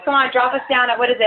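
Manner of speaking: ordinary talk, nothing marked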